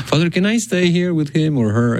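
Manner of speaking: high-pitched mock request; imitated pleading parent. Parent asking priest to stay with child, high imitation.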